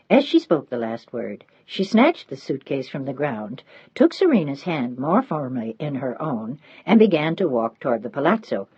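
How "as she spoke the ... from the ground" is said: usual speaking voice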